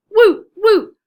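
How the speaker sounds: high, loud joyful whoops; cheering. A narrator's reading. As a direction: cheer for the hero. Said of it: "they cried".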